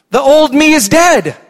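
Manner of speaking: loud, high sermon cry; full-force preaching. Acted. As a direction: preach it out to the church.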